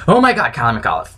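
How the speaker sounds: usual speaking voice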